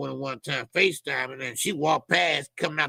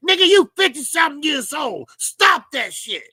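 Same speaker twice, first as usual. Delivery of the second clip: scolding shout; 9 semitones higher, 7 dB louder than usual. Real, not acted. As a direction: tell off a grown man acting silly. Scolding Bill for dancing.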